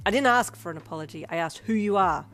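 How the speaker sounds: loud, commanding hero. A narrator's reading. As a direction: boom it out like a hero in charge. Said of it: "in your best hero voice".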